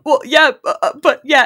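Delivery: loud, high, fumbling imitation; mock-flustered, tripping over the words; flustered stammer. Caught-out man answering a woman, comically flustered.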